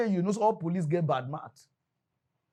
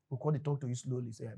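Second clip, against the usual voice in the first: ordinary talk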